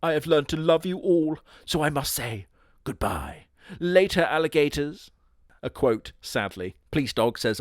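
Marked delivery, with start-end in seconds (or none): 0.0-5.1 higher, theatrical gloating voice; hammy villain reveal. Acted. Shapeshifter revealing himself to the group, hammy and theatrical. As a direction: unmask yourself with a flourish. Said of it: "is like".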